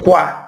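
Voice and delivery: quá said as "kwa", no final T; quá without T. Shown as a demonstration. Teacher showing the French oi sound as kwa. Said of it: "Olha só".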